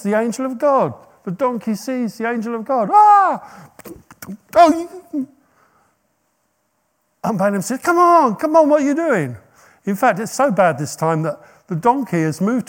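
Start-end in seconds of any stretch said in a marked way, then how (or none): 2.9-3.7 loud high startled yelp; a sudden cry of fright; high loud yelp of alarm; startled donkey cry. Retold or imitated. Donkey sees angel, cries out. 4.5-5.3 high, loud pained outburst; loud, high exasperated cry; pained annoyance. Retold or imitated. Balaam hurt, angry at donkey. 7.8-9.4 high, loud exasperated nagging; high, louder impatient scolding. Retold or imitated. Balaam scolds the donkey.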